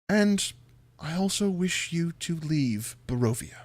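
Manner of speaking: deliberate, courtly villain; Strahd bargaining. Acted. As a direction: make the offer in measured, halting phrases. GM as Strahd makes an offer.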